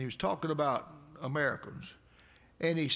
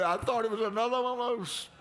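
excited; 7 semitones higher, 4 dB louder than usual. Retold or imitated. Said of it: "I said".

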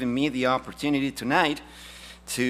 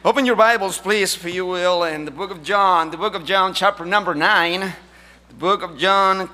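loud, raised pulpit announcement; preaching; 4 semitones higher, 8 dB louder than usual. Acted. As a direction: call the congregation to the text. Announces scripture passage.

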